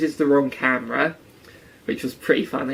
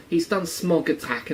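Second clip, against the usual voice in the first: usual speaking voice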